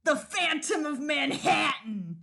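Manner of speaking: slow, grand announcing voice; dramatic title drop. Acted. Game master announcing the title to the table. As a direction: boom out the title like a trailer. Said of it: "title drop".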